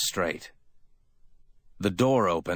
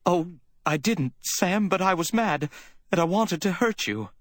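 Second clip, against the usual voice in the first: very high, plaintive woman's voice; distraught confession; 12 semitones higher than usual. A narrator's reading. Woman admitting she meant to hurt him, distraught. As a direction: confess in a high-pitched wail.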